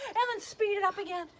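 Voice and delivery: loud, high, quick urging; speeding up. Pretend. Voice mimics speeding hops.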